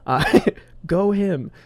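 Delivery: laughing through the words; slow, amused chuckle. Real, not acted. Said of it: "that's just a stupid phrase".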